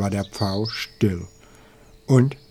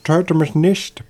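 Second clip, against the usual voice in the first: higher-pitched plea; pleading; 7 semitones higher, 3 dB louder than usual. A narrator's reading. Hawk begging the angry eagle for his life, pleading. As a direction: beg for your life. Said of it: "bat der Habicht".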